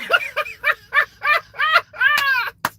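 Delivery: high burst of laughter; real laugh. Real, not acted. Laughing at the Trump bit, high loud cackle. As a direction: cackle at the joke.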